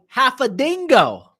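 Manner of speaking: bad Australian accent. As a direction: attempt a broad Australian accent, badly. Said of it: "a terrible attempt at an Australian accent".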